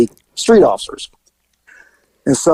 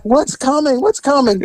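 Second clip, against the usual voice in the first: high, alarmed repeat; panicking at the voice upstairs; alarmed question; 11 semitones higher than usual. Retold or imitated. Officer calling up the stairs, high and alarmed. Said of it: "I'm like".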